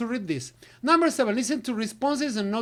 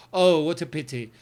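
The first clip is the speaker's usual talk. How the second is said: sympathetic low falling tone; low tone for bad news; 5 semitones lower than usual. Shown as a demonstration. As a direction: let the voice drop low in disappointment. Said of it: "Low is bad news".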